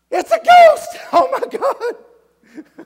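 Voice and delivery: shrieking high panic at a ghost; terrified scream. Acted. Disciples in the storm shriek at the ghost, very high and loud. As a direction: scream like you saw a ghost. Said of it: "they're like".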